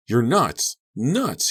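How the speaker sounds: high, loud screamed insult; screaming. A narrator's reading. Carrie yelling at Mandy, loud screaming. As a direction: scream it at her. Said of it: "he screamed".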